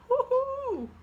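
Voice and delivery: high, loud woohoo; excited whoop. Real, not acted. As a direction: let out a high-pitched whoop of delight. Cheering at the Devil card.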